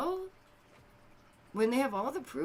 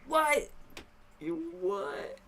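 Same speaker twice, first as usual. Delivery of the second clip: incredulous you what; 8 semitones higher, much slower than usual. Real, not acted. Incredulous reaction to excuse.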